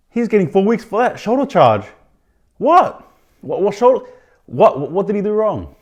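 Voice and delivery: can't believe the ruling, cry it out; outraged disbelief. Real, not acted. Host venting to viewers about a suspension, high outraged protest. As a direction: pitch shooting high, loud incredulous protest. Outraged at a four-week ban.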